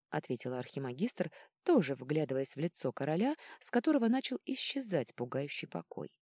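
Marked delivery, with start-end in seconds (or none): none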